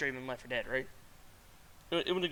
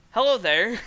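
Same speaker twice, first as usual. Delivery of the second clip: a mildly stiff, polite stranger's hello, a touch louder; scammer's formal greeting; 4 semitones higher, 6 dB louder than usual. Retold or imitated. Retelling the scammer's first message, mock-polite greeting. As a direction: lightly put on the prim tone of a scammer. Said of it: "the scammer started talking was".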